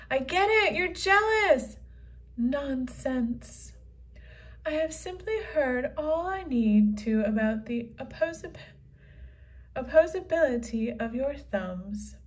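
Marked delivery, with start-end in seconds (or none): none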